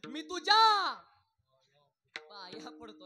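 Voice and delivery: loud, desperate father pleading with his son; a father who bursts out, then drops to a plea; begging father. Retold or imitated. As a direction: mock-desperate dad begging his boy. Father begs son to study.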